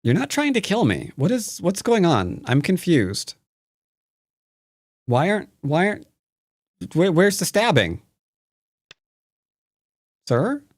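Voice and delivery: high, whiny fake heartbreak; mock hurt.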